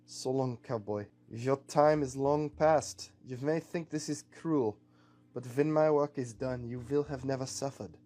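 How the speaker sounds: put-on accented villain's solemn farewell; deliberate, grave villain pronouncing a farewell; villain captain, solemn. Captain to the cowboy he has just shot, solemn farewell.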